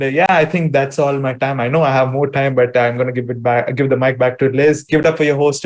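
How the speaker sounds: normal talking voice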